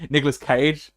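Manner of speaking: normal talking voice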